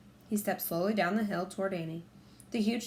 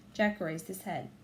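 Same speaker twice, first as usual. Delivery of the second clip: plain reading voice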